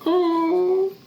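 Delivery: high scared gasp-like oh; frightened squeak. Acted. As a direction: squeak it out in fear. Captive NPC reaction.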